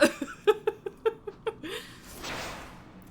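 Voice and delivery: high unvoiced laughter after a coughing fit; high breathless laugh. Real, not acted. Streamer recovering from a coughing fit, high breathless laugh. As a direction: laugh helplessly, breath gone.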